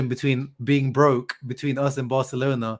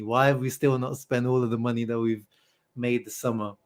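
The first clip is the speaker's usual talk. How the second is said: normal talking voice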